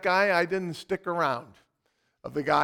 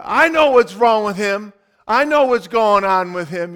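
loud, cocky know-it-all; know-it-all bystander; 4 semitones higher, 10 dB louder than usual. Retold or imitated. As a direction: boast loudly like a street expert. Bystanders claiming medical knowledge.

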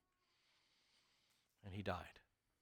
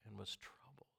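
whispered; 3 semitones lower, 19 dB quieter than usual. Acted. Whispered scripture reading.

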